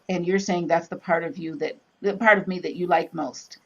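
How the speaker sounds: ordinary talk, nothing marked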